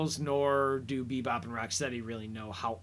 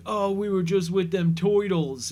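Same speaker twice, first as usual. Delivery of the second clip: hammed-up New York goon accent, higher and louder; 6 semitones higher, 7 dB louder than usual. Henchmen excitedly reporting back to their boss, loud goon accent. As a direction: play a dim-witted New York thug, loud and eager. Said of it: "goon accent there".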